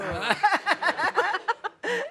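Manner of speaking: high-pitched burst of laughter; giggling high. Real, not acted. Laughing before reading the profile.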